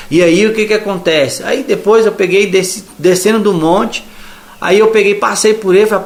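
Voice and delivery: ordinary delivery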